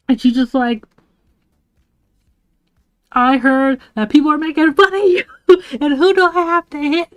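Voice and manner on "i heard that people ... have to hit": higher-pitched, protective mock threat; angry. Friend hearing the speaker is made fun of, angry. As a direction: act out a friend ready to fight for you. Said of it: "she was so angry".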